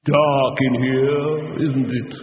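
slow, resonant voice from the dark; ominous drawn-out intonation, ringing with reverb; echoing eerie voice. A narrator's reading. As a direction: draw it out with a heavy echo. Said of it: "very close to his ear, a voice has just said".

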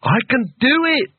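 high-pitched manic cry; wild shout. Acted. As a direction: call out wildly, voice leaping up. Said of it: "he shouted wildly".

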